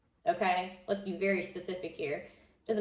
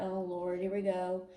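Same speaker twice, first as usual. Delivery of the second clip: ordinary talk, nothing marked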